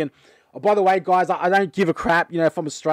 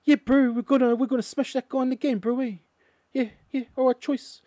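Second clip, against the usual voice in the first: much higher, hammed-up Kiwi accent; mock New Zealand accent; 7 semitones higher than usual. Vlogger imitating how New Zealanders talk, mock New Zealand accent. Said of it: "they kinda talk like this".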